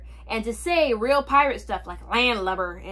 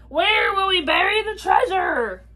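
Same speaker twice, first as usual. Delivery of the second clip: high-pitched hollered question; pirate holler; 8 semitones higher, 4 dB louder than usual. A narrator's reading. Captain asks where to bury treasure, high holler. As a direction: holler it to the whole crew. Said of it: "hollered Braidbeard".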